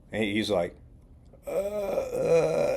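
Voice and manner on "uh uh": high, drawn-out stammer; frozen hesitation. Retold or imitated. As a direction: act out being caught blank. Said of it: "he kind of froze for a second".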